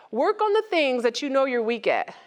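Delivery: ordinary talk, nothing marked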